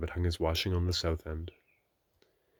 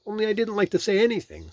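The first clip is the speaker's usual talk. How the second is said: Rabbit; 8 dB louder than usual. A narrator's reading. Rabbit voiced in Pooh story.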